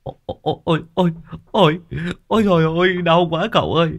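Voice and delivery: high, wailing panicked voice of a snakebitten lad; panicked young man. Acted. Tâm wails to his uncle after a snake bite, panicked high voice.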